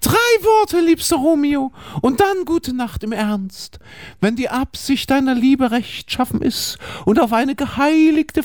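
a hint of a lovesick girl, reading aloud; lightly played Juliet; Juliet, lightly voiced. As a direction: read Juliet's plea tenderly. Reads Juliet's lines.

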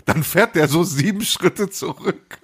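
slow, high, through laughter; laughing. Real, not acted. Storyteller mocking friend's reversing ritual, slow high laughing delivery. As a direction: draw it out while cracking up.

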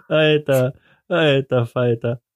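normal talking voice

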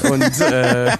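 high giggle breaking through; cracking up at own joke; laughing. Real, not acted.

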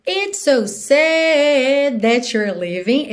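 "sad" held long over several notes; SAD drawn out. Shown as a demonstration. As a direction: stretch "sad" as in the melody. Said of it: "Esse sad fica alongado".

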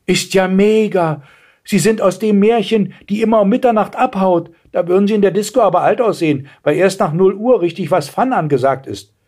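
high-pitched teasing youngster; cheeky girl. Acted. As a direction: tease like a pert girl. Girl teases the old lady. (freches Mädchen, hohe Stimme)

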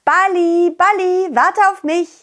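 high call after the ball. Acted. Calling after rolling ball.

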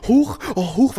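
high startled little huch; hammed-up flustered yelp; mock nervous gasp. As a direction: squeak it like a startled waitress. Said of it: "war so voll nervös".

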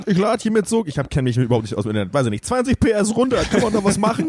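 high, fake clueless customer voice; clueless caller. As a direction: play the helpless caller.

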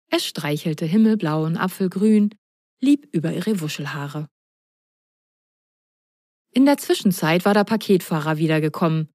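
ordinary delivery, nothing marked

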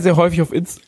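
words cut off by choking on cocoa; choking on a drink. Real, not acted. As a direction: voice catching as cocoa goes down wrong. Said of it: "oh Gott, verschluckt".

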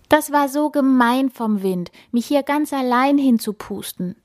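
high, sulky little snowflake; mildly grumbled complaint; grumbling. A narrator's reading. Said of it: "grummelt die Schneeflocke". (leicht grummelnd)

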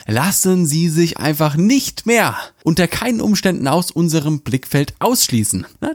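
ordinary talk, nothing marked

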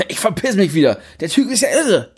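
high angry outburst; angry exit. Acted. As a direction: storm off, fed up. Niklas storming off.

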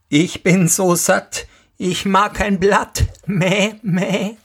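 hoch und meckernd, satt und zufrieden; zufriedene Ziege. Acted. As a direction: eine Ziege, die dem Sohn schmeichelt. Die Ziege antwortet dem Sohn. (hohe, meckernde Ziegenstimme, zufrieden)

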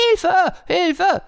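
very high, loud panicked cry; screeching for help. Acted. Peacock carried off by the fox, panicked shriek. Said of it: "kreischte der Pfau noch".

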